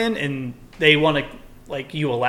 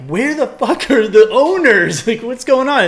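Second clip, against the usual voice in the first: higher, louder, slowed angry yell at missing owners; retold outrage; 9 semitones higher, 5 dB louder than usual. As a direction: let it burst out in disbelief, dragged out. Retelling a rude dog encounter.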